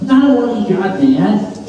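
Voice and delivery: usual speaking voice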